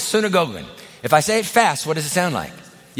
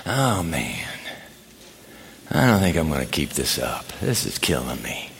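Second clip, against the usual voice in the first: much lower, softer voice of a tired churchgoer; weary quitter; 6 dB quieter than usual. Pretend. Preacher voicing a worn-out member ready to quit, low and drained. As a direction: sink down into a defeated groan.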